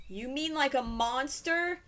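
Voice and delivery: usual speaking voice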